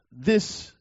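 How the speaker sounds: ordinary delivery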